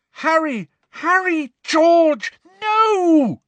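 very high, loud, desperate cries; screaming. A narrator's reading. Girls see Harry and George attack Malfoy, screaming. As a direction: scream to stop them, building to the last cry. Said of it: "He could hear girls' voices screaming".

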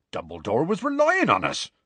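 insist, offended at the suggestion; outraged. A narrator's reading. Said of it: "looking outraged".

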